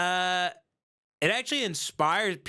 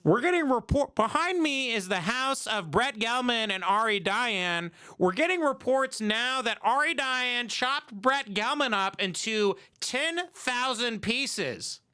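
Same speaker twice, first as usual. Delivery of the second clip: higher-pitched mock live news report; news reporter; 6 semitones higher than usual. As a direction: report breaking news, hammed-up.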